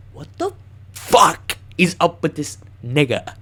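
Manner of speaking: slow, loud retold "what on earth" reaction; acted incredulous outburst. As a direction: stretch out the disbelief, punching the swear word. Retelling his shocked outburst at man.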